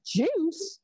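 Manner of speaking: mock-suspicious high questioning echo; puzzled query. Policeman hears son mention juice, suspicious query. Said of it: "So the policeman says".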